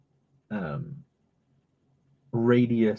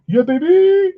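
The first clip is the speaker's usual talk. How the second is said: excited; 9 dB louder than usual. Acted. Hyping self while coloring.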